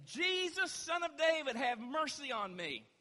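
high, loud cry for mercy, easing at the end; desperate calling out. Acted. Blind beggar calls to Jesus passing by, desperate shout. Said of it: "he called out, saying".

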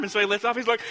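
usual speaking voice

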